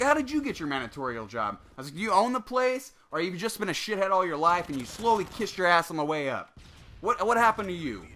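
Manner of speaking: retold scornful tirade; losing his shit. Customer retelling how he mocked the manager's job, angrily. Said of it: "lost my shit on him".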